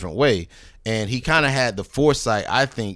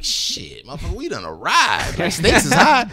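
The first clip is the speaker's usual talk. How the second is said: thrilled reaction; 6 semitones higher than usual. Retold or imitated. Thrilled at comparison to Stakes is High.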